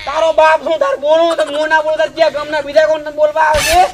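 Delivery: shouted, high-pitched tirade; angry scolding. Acted. Elder rebuking a young relative who interrupted, furious shouting. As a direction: shout them down without letting up. Father scolds in family quarrel.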